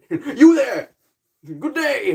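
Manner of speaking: high, loud hammed-up knightly hail; mock chivalrous greeting. Knight hailing a stranger before striking, hammed-up and loud. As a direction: call out like a jolly knight before the ambush.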